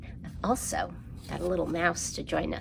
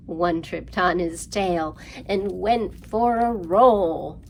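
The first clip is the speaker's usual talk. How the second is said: slow lively rhyme, louder on the roll and growl; rhyme for kids; 6 dB louder, slower than usual. Acted. Librarian reciting counting rhyme to children, lively. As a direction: act out the lions tumbling.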